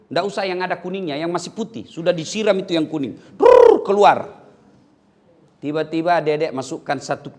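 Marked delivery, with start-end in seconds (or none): 3.4-4.4 loud, slow drawn-out brrr with lips; playful gross-out sound effect for the kids; mock gush noise. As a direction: make the sound of it bursting out. Mimicking something gushing out.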